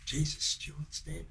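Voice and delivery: breathy hushed gasp of news; half-whispered shock, as if just told. Retold or imitated. Partygoer passing on shock news, hushed whisper.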